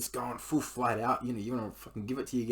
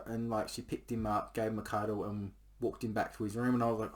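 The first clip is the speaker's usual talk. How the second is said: ordinary delivery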